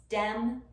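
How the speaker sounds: dem, TH stopped to a D; TH stopping. Shown as a demonstration. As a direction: them said as dem. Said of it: "them becomes dem".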